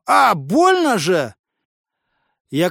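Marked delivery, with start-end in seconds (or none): 0.1-1.3 pain cry. Acted. Hammer falls on his foot.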